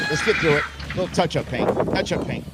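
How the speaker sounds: ordinary delivery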